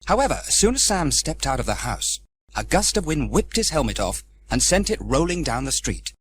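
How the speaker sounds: usual speaking voice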